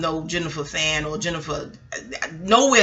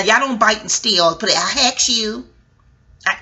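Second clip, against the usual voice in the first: ordinary delivery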